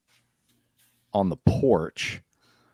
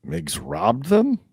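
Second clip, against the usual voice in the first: higher, slow disbelieving question; guards surprised; 7 semitones higher, 5 dB louder, slower than usual. Acted. Guards stunned to hear Migs robbed them, slow surprised question. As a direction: let the shock land on robbed. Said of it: "they just said".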